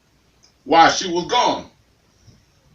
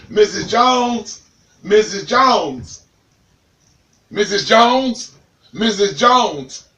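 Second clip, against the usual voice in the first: calling out; 6 semitones higher, slower than usual. Acted. Mailman calling at the gate.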